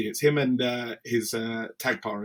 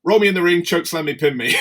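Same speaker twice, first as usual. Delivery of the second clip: excited retold instructions; 6 semitones higher, 9 dB louder, faster than usual. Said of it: "And he was like".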